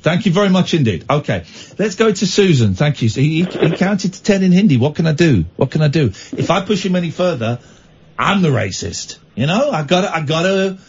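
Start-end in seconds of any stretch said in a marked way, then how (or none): none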